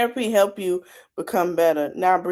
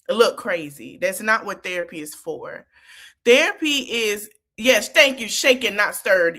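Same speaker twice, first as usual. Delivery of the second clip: ordinary talk, nothing marked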